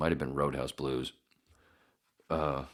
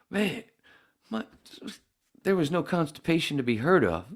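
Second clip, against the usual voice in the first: excited; 11 semitones higher, 4 dB louder than usual. Real, not acted. Excited toilet story.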